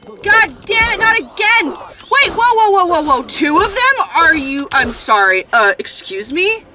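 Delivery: high, loud burst of startled disbelief; real alarmed, indignant shouting; outraged shouting. Real, not acted. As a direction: yell in shock at a sudden ambush. Ambushed by two assassins in game.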